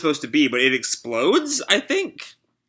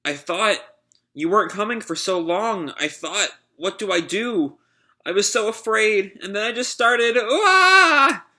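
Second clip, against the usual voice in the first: put-on higher little boy voice rising to a cry; a frightened kid confessing, ending in a wail; scared child; 8 semitones higher than usual. Child tells dad his fear.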